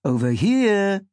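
second voice echoing, loud and drawn out. A narrator's reading. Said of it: "second voice in a deeper tone".

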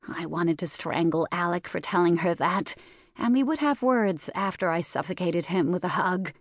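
ordinary delivery

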